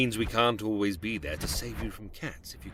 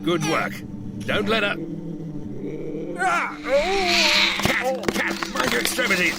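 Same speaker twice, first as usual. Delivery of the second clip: high, loud urgent calls; excited shouting; 11 semitones higher, 9 dB louder than usual. Acted. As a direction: bark orders mid-chase. Creature urging on the chase.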